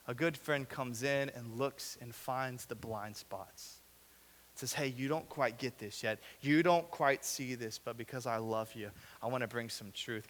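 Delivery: soft, slow and earnest; quiet sincere truth. Real, not acted. Sincere turn after sarcasm.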